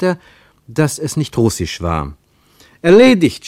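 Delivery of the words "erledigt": high, loud desperate yell; full-voiced, exasperated cry. A narrator's reading. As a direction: shout it down a bad phone line. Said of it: "schrie er".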